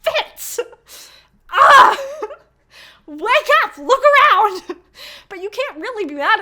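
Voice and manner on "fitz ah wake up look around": much higher, loud frustrated shouting; frustrated reader shouting at the page; exasperated yelling. Retold or imitated. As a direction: yell at a character who won't see it. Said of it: "You reading the book is like".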